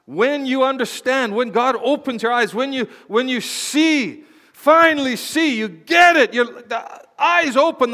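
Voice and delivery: high, loud, rising sermon peaks; full-force preaching. Acted. Pastor preaching to the church about eyes opening, fervent. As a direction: preach it to the rafters.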